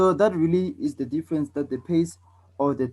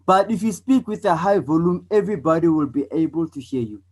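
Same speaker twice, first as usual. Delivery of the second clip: louder, higher, projected voice; raised teaching voice; high volume; 3 semitones higher, 4 dB louder than usual. Shown as a demonstration. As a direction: project so everybody can hear. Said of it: "So can you tell the difference?".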